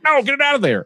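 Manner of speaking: high, mock panic; panicked straight guy. Straight guy recoiling at the suggestion, high mock panic. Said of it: "But a straight guy's like".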